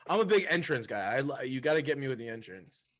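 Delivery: ordinary delivery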